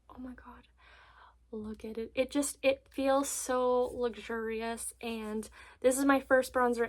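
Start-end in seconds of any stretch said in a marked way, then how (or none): 0.1-2.1 soft breathless whisper of awe; awed whisper. Real, not acted. Unboxing the bronzer she came for, awed whisper. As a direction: gasp it out in wonder. Seeing product she wanted.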